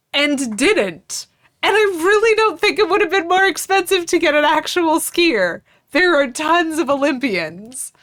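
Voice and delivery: high, incredulous complaint; mad. Real, not acted. Co-host arguing they needed a real skier, indignant. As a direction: argue it indignantly, pitched high. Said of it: "I'm legitimately mad about this".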